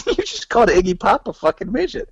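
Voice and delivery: high, laughing disbelief; imitated astonishment breaking into laughs; retold amazed punchline. Delivering the punchline of his story.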